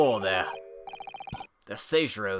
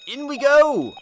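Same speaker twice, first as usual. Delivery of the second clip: very high, loud triumphant call; eager cheer; 13 semitones higher, 9 dB louder, slower than usual. Acted. Sholmes urging his friend inside, high loud cheer. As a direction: sweep in like a proud detective.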